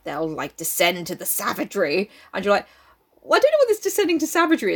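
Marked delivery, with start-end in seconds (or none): 3.2-4.7 high, fast put-on sceptic; mock objection. Reader voicing a doubter's reply, mock sceptical. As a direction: rattle it off in a raised quoting voice.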